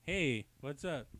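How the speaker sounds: slow, stiff reenacted line; lightly voiced stiff comic panel greeting; reenacting a comic panel. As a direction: read it like a blank-faced comic character. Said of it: "Can we reenact one of the panels?".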